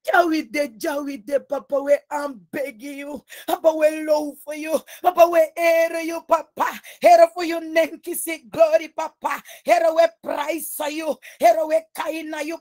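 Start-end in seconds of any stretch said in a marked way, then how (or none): none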